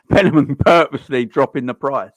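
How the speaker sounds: exasperated loud call at the screen; loud exclaim. Real, not acted. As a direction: call it out loud, fed up with the player. Watching match, exclaiming at player.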